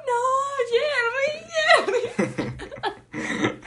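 hammed-up dream crying; mock crying through laughter. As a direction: wail his name while cracking up. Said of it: "Y yo llorando".

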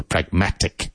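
"pragmatic" stressed on MAT; prag-MAT-ic, a teacher's model of "pragmatic"; stress on second syllable. Shown as a demonstration. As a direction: lean on the middle syllable of "pragmatic". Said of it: "emphasis on the second syllable".

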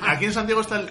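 usual speaking voice

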